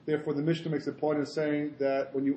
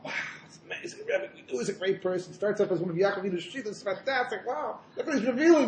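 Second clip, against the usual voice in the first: hushed mock wonder rising higher; amazed followers; 4 semitones higher than usual. Chassidim marvelling at their Rebbe, acted amazement. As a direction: imitate awestruck disciples marvelling at their leader. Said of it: "the Chassidim are all amazed".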